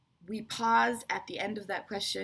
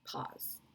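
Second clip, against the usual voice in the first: a spoken pause, said softly after a break; demonstrated pause; 10 dB quieter than usual. Shown as a demonstration. Teacher showing a comma break, said softly. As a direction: breathe the word pause into the silence. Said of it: "each comma is a short break".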